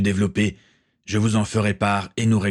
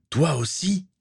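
higher-pitched, incredulous question; exclaiming; 8 semitones higher, slower than usual. A narrator's reading. Gaël learning his friend bet too, exclaiming. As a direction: question her in mild disbelief. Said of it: "s'exclama Gaël".

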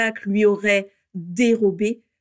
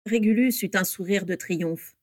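usual speaking voice

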